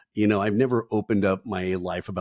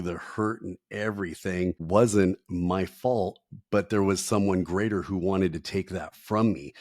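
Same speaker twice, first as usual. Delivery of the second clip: usual speaking voice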